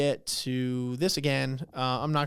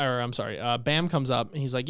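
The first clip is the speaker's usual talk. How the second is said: normal talking voice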